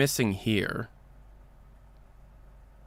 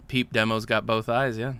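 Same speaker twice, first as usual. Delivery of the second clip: ordinary delivery, nothing marked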